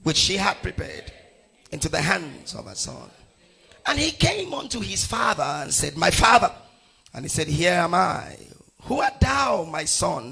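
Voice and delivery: emphatic, measured Bible reading; scripture reading. Acted. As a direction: proclaim the verse phrase by phrase. Reads scripture aloud to congregation.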